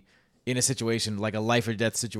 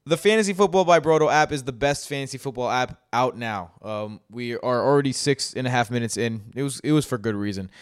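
louder, upbeat sponsor pitch; ad read; 3 semitones higher, 6 dB louder than usual. Acted. Host turns to the sponsor, upbeat louder ad read. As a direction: sell the app like a host ad break.

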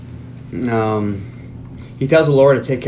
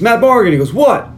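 calling; 8 semitones higher, 4 dB louder than usual. Retold or imitated. Calling name.

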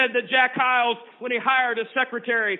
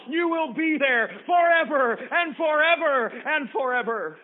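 slow, loud, high preacher's cadence; fiery preaching; 5 semitones higher, 3 dB louder than usual. Acted. Preacher warning the congregation about hell, booming and slow. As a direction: hammer it home from the pulpit.

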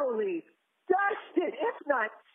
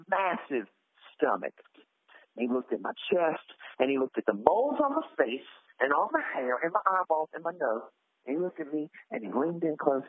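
deep character voice; 12 semitones lower than usual. Acted.

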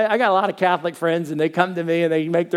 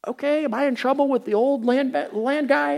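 mock worry; 9 semitones higher than usual. Worried aside about trouble.

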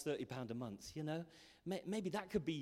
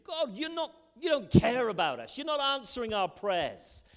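higher, louder protest; indignant complaint; 6 semitones higher, 6 dB louder than usual. Acted. People of God complain their prayers go unanswered, indignant. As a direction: plead your case in exasperation.

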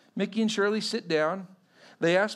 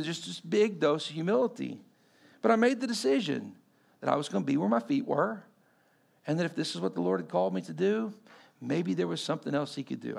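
ordinary talk

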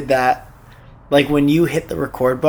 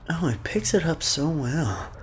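imitating breathy voice; 3 semitones higher, 7 dB quieter than usual. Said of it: "You were like".

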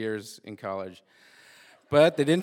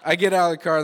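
normal talking voice

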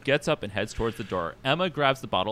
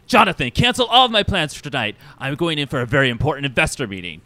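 imperious diva boss; 2 semitones higher, 6 dB louder than usual. Acted. Vain boss phoning his assistant Jonathan, imperious and dramatic. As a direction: command the assistant with grand urgency.

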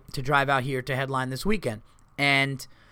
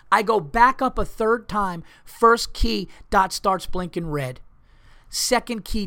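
ordinary talk, nothing marked

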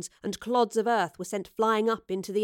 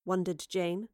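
ordinary delivery, nothing marked

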